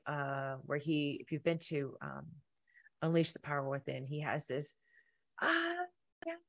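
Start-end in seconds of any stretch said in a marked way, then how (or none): 5.4-6.3 imitating a stage coach rousing the crowd; Tony Robbins impression. Speaker mimics Tony Robbins on stage, high cry. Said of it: "he's like".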